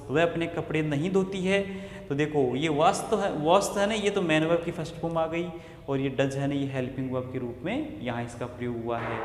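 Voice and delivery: ordinary delivery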